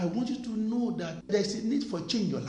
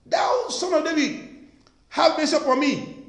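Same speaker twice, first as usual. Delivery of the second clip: high, loud retold plea; retold cry, pitched up and building louder; 7 semitones higher, 5 dB louder than usual. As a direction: imitate a blind man calling out for mercy. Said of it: "and he was crying".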